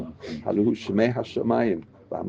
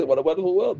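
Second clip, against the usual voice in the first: mock gushing; 8 semitones higher, faster than usual. Mimicking nature admirers.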